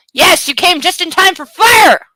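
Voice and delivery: loud, high gleeful shout; excited shout. Real, not acted. Shouting gleefully to pet Cecil about fire, excited. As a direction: cheer the pet's arrival.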